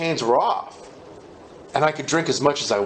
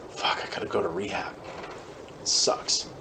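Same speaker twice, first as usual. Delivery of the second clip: softer, half-whispered complaint; muttered grumble; 5 semitones lower, 9 dB quieter than usual. Retold or imitated. Man arriving at rehab against his will, muttering. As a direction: grumble it under your breath.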